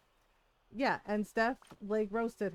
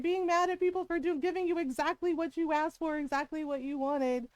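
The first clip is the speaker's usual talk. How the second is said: retelling the punchline higher and louder, half laughing; excited retelling; 8 semitones higher, 4 dB louder than usual. Retold or imitated. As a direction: repeat the daughter's zinger gleefully, up high. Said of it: "'cause Steph was like".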